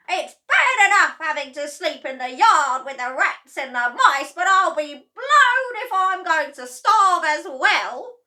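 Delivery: high, very loud furious roar; Grandma shouting. A narrator's reading. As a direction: bellow down at the family. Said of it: "she shouted".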